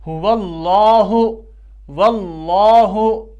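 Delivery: slow recited हुवल्लाहु with the लाम full and thick; heavy lam. Shown as a demonstration. Teacher shows students heavy lam in हुवल्लाहु, slow recitation. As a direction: draw out वल्लाहु, lam heavy. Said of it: "पुर आवाज़ में पढ़ा जा रहा है".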